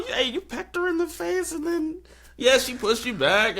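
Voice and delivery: high, light mock jab; half-hearted retort. Producer answering the rival team, lightly voiced. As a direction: point out who started it. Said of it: "but also".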